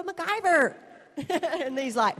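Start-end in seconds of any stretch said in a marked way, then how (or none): 0.0-0.8 MacGyver called very high and loud with a hard final r; American-style call. Shown as a demonstration. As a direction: belt out "MacGyver" the American way, r and all. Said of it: "'Cause you gotta go".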